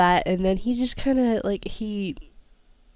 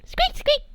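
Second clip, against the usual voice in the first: tiny high mouse squeaks, much higher; little squeaks. Retold or imitated. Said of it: "there's a little squeak".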